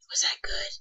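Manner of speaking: hushed, unvoiced check-in; soft secretive question; whispered aside. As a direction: whisper it to your co-host. Whispered aside after the intro.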